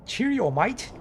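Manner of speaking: high, bright put-on British accent; cheery mock British greeting. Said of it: "I'm terrible at making accents".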